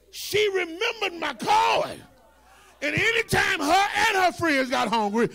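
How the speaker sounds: preached anecdote, loud with drawn-out stressed words; full-force preaching. Acted. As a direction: tell the grievance at preaching pitch. Sermon story at full force.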